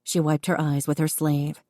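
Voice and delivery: ordinary delivery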